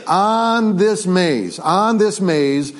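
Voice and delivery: slow, deliberate preacher setup; very slow emphasis. Real, not acted. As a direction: drag out on this maze. Drawing out the point.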